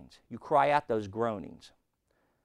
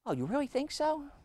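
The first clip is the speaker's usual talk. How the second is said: high, sceptical mock question; mock doubtful reply; 13 semitones higher, 5 dB quieter than usual. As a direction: sound unconvinced, mocking the doubter. Congregant doubts the advice.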